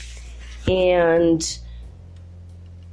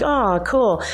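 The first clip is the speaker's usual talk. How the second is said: unimpressed; 6 semitones higher than usual. Retold or imitated. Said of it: "And I'm like".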